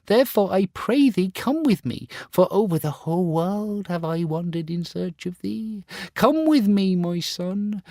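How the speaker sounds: very high, imploring mother's voice; pleading mother. Acted. Mother begging her son to come with her, very high. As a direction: beg the lost son to come home.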